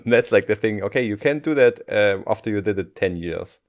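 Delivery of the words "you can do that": normal talking voice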